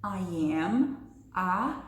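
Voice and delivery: "I am a" dragged slowly, then a pause before "engineer"; slow and broken. Shown as a demonstration. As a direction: gasp between "a" and "engineer". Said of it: "De novo".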